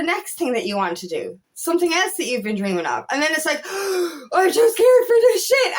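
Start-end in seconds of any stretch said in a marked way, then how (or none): 3.7-4.3 mock gasp. Acting a scared reaction. 4.3-5.7 high, loud, half-crying mock panic; hammed-up frightened cry; mock panicked wail. Acting panic at next step.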